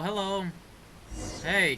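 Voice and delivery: normal talking voice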